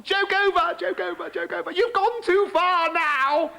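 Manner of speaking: high, slow, desperate frog voice; frog pleading. Acted. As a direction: beg to be let out.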